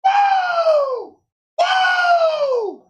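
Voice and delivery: very high, long "woo"; ecstatic whoop. Real, not acted. Fan celebrates his team's pick, ecstatic whooping. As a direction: whoop at the top of your range.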